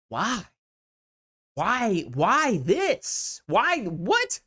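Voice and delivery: high, swooping exasperated questioning; mock bafflement. Onlooker faced with a tasteless chopper, hammed-up high bewilderment. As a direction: plead for an explanation, hammed-up. Voices viewer's baffled reaction.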